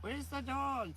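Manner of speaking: put-on high, playful kid asking; mock child voice. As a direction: voice a curious little kid. Mocking kid searching.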